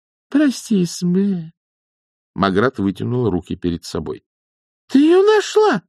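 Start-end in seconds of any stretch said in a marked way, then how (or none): 0.1-1.5 lightly contrite, high voice; put-on remorse. A narrator's reading. Nanny scolded by her friend, apologizes with feigned remorse. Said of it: "мигом изобразила раскаяние и повесила голову". 4.9-5.8 very high, louder cry; excited exclamation. A narrator's reading. Nanny questions young witch about the wand, exclaiming. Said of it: "воскликнула нянюшка".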